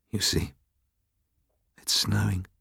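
father at the door, musing to himself. A narrator's reading. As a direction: let it drift out, talking to himself. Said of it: "as if to himself".

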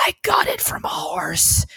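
confiding whisper, slowed down. Retold or imitated. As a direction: lean in and whisper it slowly. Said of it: "I leaned over and said".

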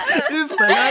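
high burst of laughter; laughing. Real, not acted. Reacts to the wig joke. (ضحك عالٍ)

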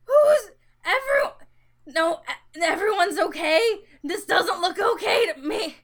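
high, loud panicked cry. Acted. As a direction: cry out in panic, near tears. Character panicking amid fire.

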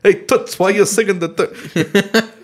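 high, strained mock voice, drawn out; imitating blown-out singer. Joking about who sings the third verse, high mock imitation. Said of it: "he was just like".

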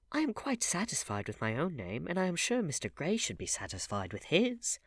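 high-pitched duchess voice; high, swooping society lady; duchess, objecting. A narrator's reading. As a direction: object politely in a high, lilting voice.

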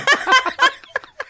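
shrieking burst of laughter; high squealing laugh. Real, not acted. Laughing at own joke.

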